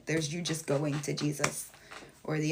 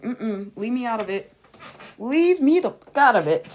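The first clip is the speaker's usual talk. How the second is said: dismissive refusal; 4 semitones higher, 10 dB louder than usual. Retold or imitated. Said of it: "That's why I'm like".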